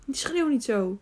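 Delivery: plain reading voice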